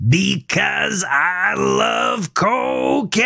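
high, slow, showy cry with jazz hands; enthusiastic outburst. A narrator's reading. Melvin, high on cocaine, shows off to Gavin, high slow shout. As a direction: belt it out like a manic showman. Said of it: "With jazz hands and enthusiasm".

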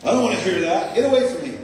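loud annoyed atheist waving him off; irritated stranger. Retold or imitated. Atheist rejects him, acted.

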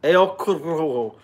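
[name] with a trilled r, "el ko-RRo-ho"; rolled R. Shown as a demonstration. Reading the band aloud, rolling the r in [name]. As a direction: lift your head and roll the r in Corojo. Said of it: "I gotta hold my head up to do it".

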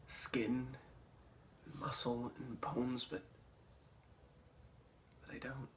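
slow, very soft, spaced-out phrases; almost whisper each image slowly; eerie hush. Acted. [name] describing the split skin in his dream, hushed.

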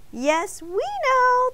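high, cheery group-style shout; bright chorus-like call; shouting back. A narrator's reading. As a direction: sing it out loud, stretched high. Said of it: "the donuts shouted back".

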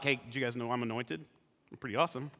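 low, soft, offhand humblebrag; bragging. Acted. Youngest brother bragging about being anointed, smugly casual. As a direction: toss the brag off quietly. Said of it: "went around bragging about".

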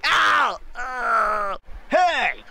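high yelps of pain. Acted. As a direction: yelp on the bump. Collision yelps.

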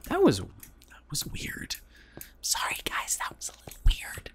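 half-whispered apology; voiceless, sheepish murmur to the viewers; sheepish aside. Real, not acted. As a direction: drop to an embarrassed near-whisper. Sheepish apology after failed impression.

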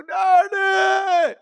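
high, very drawn-out wail of "daddy"; imitated crying call. Son clinging to father, crying "daddy" in a long wail. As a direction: stretch out "daddy" like a sobbing boy. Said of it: "అన్నాడు".

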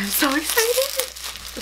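high, slow, drawn-out gush; dramatic excitement. Real, not acted. Digging into the box, she gushes dramatically to viewers. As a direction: overdo the excitement on purpose. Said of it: "Sorry if I sound so dramatic".

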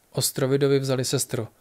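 ordinary delivery, nothing marked

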